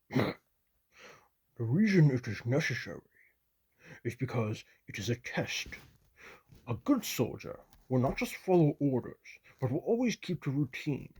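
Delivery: put-on gruff old commissar's voice; gruff, measured words of a mentor. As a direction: quote the senior commissar in a gruff voice. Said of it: "in his gruff voice".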